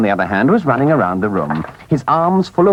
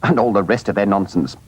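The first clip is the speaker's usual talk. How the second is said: ordinary delivery, nothing marked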